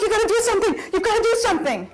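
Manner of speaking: high, laughing plea for help; mock-panicked high plea; panicky pleading. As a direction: beg the boyfriend to deal with the spider. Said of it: "I'm like".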